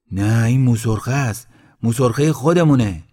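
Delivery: emphatic correction. A narrator's reading. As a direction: cut in loudly to set them straight. Villager corrects the man fetching stones.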